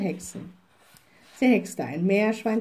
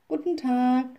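lightly voiced children, a bit higher; children's greeting; 4 semitones higher than usual. A narrator's reading. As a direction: greet politely as two children. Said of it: "sagten sie". (leicht angedeutete Kinderstimmen beim Grüßen)

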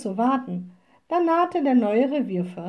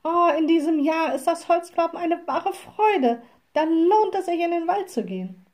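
delighted, higher-pitched exclamation; happy; 7 semitones higher than usual. A narrator's reading. Wood gatherers praise this year's wood to the witch, happily. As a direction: glad villager voice, lifted high. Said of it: "machten frohe Gesichter".

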